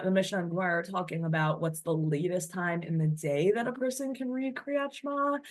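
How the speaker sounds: ordinary talk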